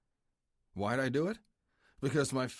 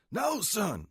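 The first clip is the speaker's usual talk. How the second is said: higher, louder roar of alarm; 12 semitones higher, 5 dB louder than usual. Acted. President roars at his son to stop. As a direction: bellow a warning to stop him. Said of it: "Blackjack roared".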